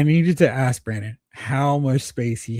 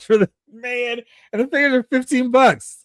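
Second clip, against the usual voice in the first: high, loud incredulous rant; mock outrage; 11 semitones higher, 5 dB louder than usual. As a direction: exclaim as if heads are exploding. Mock outrage at toy prices.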